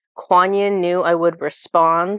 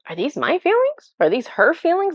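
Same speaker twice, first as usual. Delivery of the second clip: asking high and bewildered; pitched-up confused musing; puzzled high questions; 10 semitones higher than usual. Retold or imitated. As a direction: wonder aloud, lost in confusion. Confused by a strong feeling.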